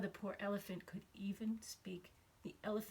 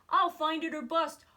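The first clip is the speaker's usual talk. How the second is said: louder, higher vow; determined cry; 7 semitones higher, 11 dB louder than usual. Acted. Horton vowing to find his friends, determined cry. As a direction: cry it out with resolve. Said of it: "cried Horton".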